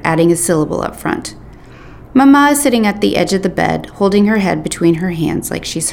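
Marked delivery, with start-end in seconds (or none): none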